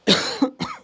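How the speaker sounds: sharp coughing fit. Real, not acted. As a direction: cough hard into the mic. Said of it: "Coughing into the mic".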